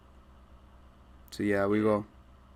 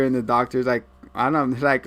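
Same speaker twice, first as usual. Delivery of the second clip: ordinary delivery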